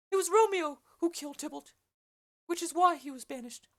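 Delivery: put-on high-pitched old nurse voice, loud and pressing; the Nurse explaining the killing, high and loud. Nurse's lines in read play.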